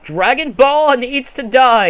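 slow, high, emphatic call-out; loud declaration. Real, not acted. Gamer denouncing Dragon Ball mid-game, loud emphatic declaration. Loud opinion while striking out Toriyama.

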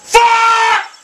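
high furious yell; angry shout. Real, not acted. Gamer screaming after another bad putt, furious. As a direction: scream out the frustration.

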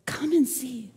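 soft "Come and see" as one phrase; quietly invite, without pauses between words; gentle invitation. Acted. Philip invites doubting Nathanael, gently and softly.

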